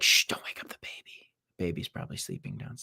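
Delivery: imitated shushing whisper; hushed whisper. Streamer imitating wife shushing him over sleeping baby, whispered. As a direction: whisper it so the baby stays asleep. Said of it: "She's probably like".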